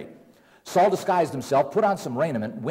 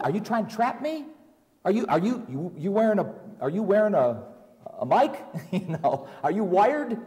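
higher, anxious, stumbling questions; nervous suspicion, voice climbing; afraid; 5 semitones higher than usual. Acted. Witch fearing a trap from the king, afraid and higher. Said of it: "She was afraid".

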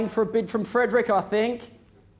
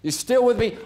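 ordinary talk, nothing marked

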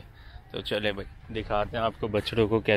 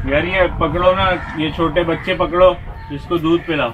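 calling out; 7 semitones higher, 9 dB louder than usual. Real, not acted. Calling to helper across farm.

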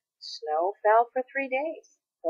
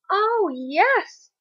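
much higher, loud joyful shout; delighted cry; 12 semitones higher, 5 dB louder than usual. A narrator's reading. Peter hearing the plan for his tree, delighted cry. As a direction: burst out with joy. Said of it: "cried Peter".

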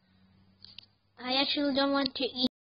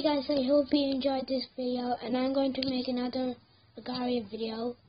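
ordinary talk, nothing marked

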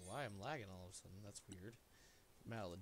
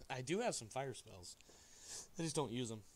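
ordinary talk

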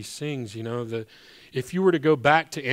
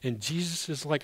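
usual speaking voice

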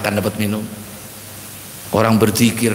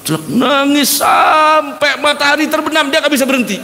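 emphatic outcry; 13 semitones higher than usual. Acted. Dramatic retelling of the weeping.

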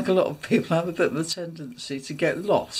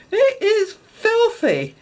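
"It is filthy" drawn out, high and loud; scandalised emphasis; 6 dB louder, much slower than usual. Retold or imitated. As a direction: stretch "filthy" slowly in mock horror. Husband repeats it with emphasis.

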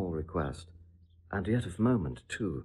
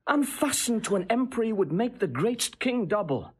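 much higher, heated and insistent; impassioned tirade; 11 semitones higher than usual. Acted. Seducer whips up the princess against her husband, soaring and heated. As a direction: work her up, climbing high with outrage.

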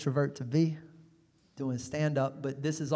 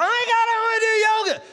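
very high, loud mimicking squeal; mock excited shout; 6 dB louder than usual. Imitating her announcing yoga, high mock shout. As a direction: shout it high like an oblivious enthusiast. Mimics an oblivious eager companion.

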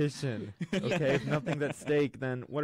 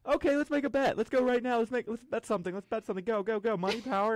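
high-pitched, rapid-fire mock eagerness; excited pushy imitation; 10 semitones higher than usual. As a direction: imitate her egging you on, voice way up. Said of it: "she's like".

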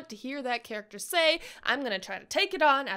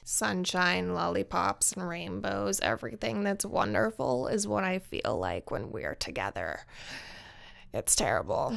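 deep monotone voice; 9 semitones lower, 4 dB quieter than usual. Acted.

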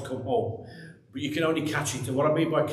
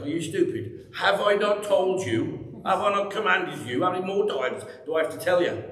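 mock-exasperated scolding, rising high on the middle questions; playful exasperated rebuke, God chiding Joshua; 6 semitones higher than usual. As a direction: ham up a fed-up parent asking "are you stupid". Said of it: "he's like".

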